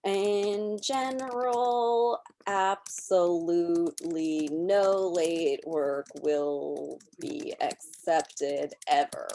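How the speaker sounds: slow, even dictation of a sample sentence; slow typed-out example text. Shown as a demonstration. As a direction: read it out word by word as you type. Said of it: "my normal text is going to say something like".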